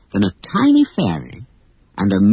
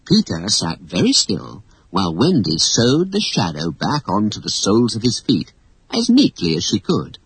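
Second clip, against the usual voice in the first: ordinary delivery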